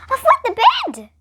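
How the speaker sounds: high, loud startled cry; alarmed cry. Acted. Denver waking in the flooded room, alarmed cry. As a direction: wake up shocked. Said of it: "Denver cried".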